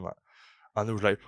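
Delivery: ordinary talk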